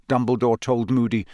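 ordinary delivery, nothing marked

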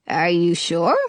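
low, disbelieving genie voice; lightly voiced genie in shock; stunned genie. A narrator's reading. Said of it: "total shock".

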